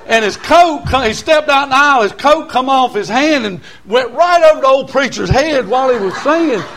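high, excited revival storytelling; fired-up country preacher; full-force preaching. Real, not acted. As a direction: preach the tale with mounting excitement, pitched high.

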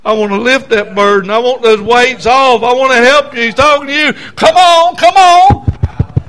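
loud, rising plea building to a shout; preacher voicing an insistent divine appeal; Holy Spirit urging. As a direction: call them forward with mounting force. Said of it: "the Holy Spirit said".

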